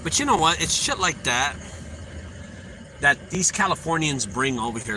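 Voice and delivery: ordinary delivery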